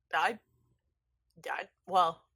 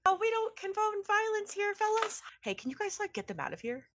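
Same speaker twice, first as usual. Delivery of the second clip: high, put-on sheepish creep voice; Wayward's voice; 14 semitones higher than usual. Wayward asking the party to remove the fighters, high and sheepish. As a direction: pipe up high and apologetic. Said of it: "Wayward pokes his head around the cabinet and is like".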